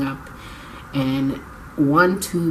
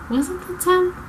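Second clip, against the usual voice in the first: surprised question. Real, not acted. Doubting her own date.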